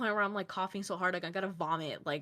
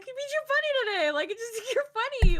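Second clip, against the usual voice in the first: excited insistence; 7 dB louder than usual. Retold or imitated. Insisting friend is funny today.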